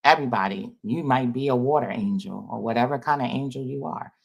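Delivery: ordinary talk, nothing marked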